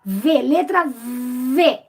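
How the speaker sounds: "V, letra V" said very slowly, the letter V held long; letter name drawn out. Shown as a demonstration. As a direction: stretch out "V" slowly for the pupil. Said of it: "Fala essa letra". (professora ao aluno, diz "V" bem devagar e alongado)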